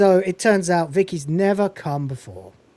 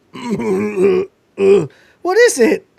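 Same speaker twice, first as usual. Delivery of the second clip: drawn-out question; 4 semitones higher, 6 dB louder, much slower than usual. Retold or imitated. Girl asking again.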